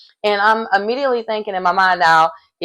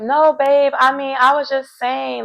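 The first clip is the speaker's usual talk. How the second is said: mock innocent; 6 semitones higher than usual. [name] backpedaling to Jimmy.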